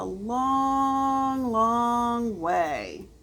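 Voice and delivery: slow, stretched long way; drawn out. A narrator's reading. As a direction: stretch the words to show the distance.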